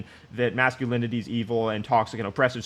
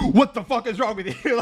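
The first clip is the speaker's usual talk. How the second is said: higher-pitched mock yell of an angry mother; mock screaming mom; 10 semitones higher, 3 dB louder than usual. Imagining a mom snapping at her sick kid, mock yell. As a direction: ham up a furious mom. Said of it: "imagine if your mother was like".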